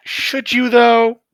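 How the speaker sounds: high, skeptical "should you, though"; Mina's doubtful question. Retold or imitated. Players recalling Mina's doubt, high skeptical question. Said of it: "even Mina went".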